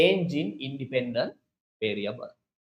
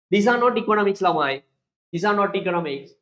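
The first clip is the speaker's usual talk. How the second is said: louder, higher on "not"; teacher correcting firmly; emphatic correction; 4 semitones higher, 7 dB louder, faster than usual. Shown as a demonstration. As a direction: insist hard on "not economics". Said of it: "no, no, no".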